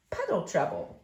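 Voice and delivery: ordinary delivery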